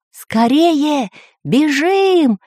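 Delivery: high, long-drawn calls; urgent cry, each word stretched and falling; Пыхтелкин crying out. A narrator's reading. Professor urges everyone to flee, crying out. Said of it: "закричал профессор Пыхтелкин".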